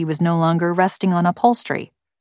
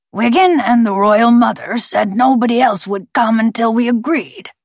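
higher, forced gravelly bird voice; 5 semitones higher, 3 dB louder than usual. A narrator's reading. Talking bird addressing Sprout from his shoulder, forced gravelly voice. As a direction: speak as a bird forcing out human words. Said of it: "In a forced, gravelly voice, he said".

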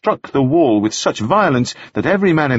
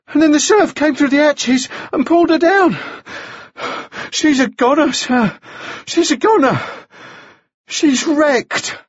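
put-on high, panting, distressed boy; ragged breath. A narrator's reading. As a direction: gasp out the shipwreck, breaking for air. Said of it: "He drew a ragged breath".

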